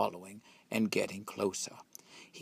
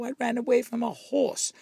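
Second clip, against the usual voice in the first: taunting squeal; 14 semitones higher than usual. A narrator's reading. Taunting chant peak.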